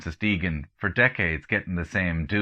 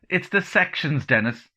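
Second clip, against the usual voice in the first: blurted out; 8 semitones higher than usual. Acted. Blurting out the haircut problem.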